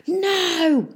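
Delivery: high-pitched cry of protest; shouted. A narrator's reading. Peavy watching the town lift off, high desperate shout. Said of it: "shouted Peavy".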